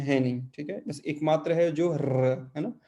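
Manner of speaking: ordinary talk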